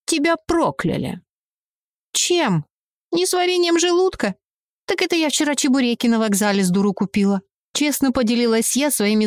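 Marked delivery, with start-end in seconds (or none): 0.1-1.2 spooky witch voice. Acted. Witch declares curse. 2.1-7.4 mock surprise. Mocking reply.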